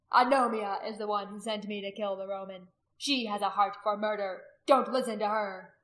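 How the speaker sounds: loud, forceful public accusation. A narrator's reading. Warrior warning the others against the priestess, loud. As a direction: address the whole gathering loudly. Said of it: "addressing the others in a loud voice".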